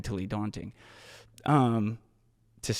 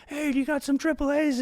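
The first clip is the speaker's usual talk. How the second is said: high-pitched imitation of calling out; retold yell. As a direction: call out high as if to people while running by. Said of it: "like, yell at some people".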